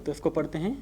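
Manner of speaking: ordinary delivery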